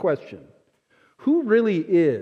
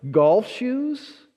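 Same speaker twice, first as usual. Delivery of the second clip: higher, rising disbelief; surprised question; 6 semitones higher, much slower than usual. Retold or imitated. Friend surprised by the narrator's sneakers, high and amazed. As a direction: sound amazed he has no golf shoes. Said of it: "He goes".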